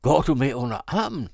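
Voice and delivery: loud, high cry of disbelief; shocked shout. A narrator's reading. Brother challenging Arthur about the lady, shouting. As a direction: call it out sharply as a challenge. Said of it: "råbte jeg".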